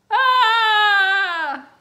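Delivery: long, high, loud wail; drawn-out kid sister shriek; horrified scream. A narrator's reading. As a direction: scream in protest at the news.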